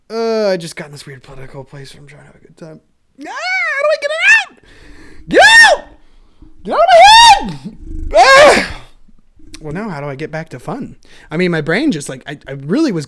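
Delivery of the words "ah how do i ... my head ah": very high, loud frantic yell; frantic shout. Real, not acted. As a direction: scream the thought out of your head. Trying to shake a thought out.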